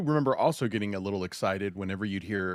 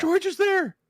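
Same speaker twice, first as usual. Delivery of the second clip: much higher, squealing excitement; giddy; 4 dB louder, slower than usual. Retold or imitated. Fan hearing the guest is on, giddy squeal. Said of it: "a tiny little bit of giddy".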